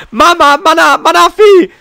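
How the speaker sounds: high, loud worried call. Acted. Calling the missing Manafi, loud high shout. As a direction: shout the name across the room. Searching for lost Pokémon.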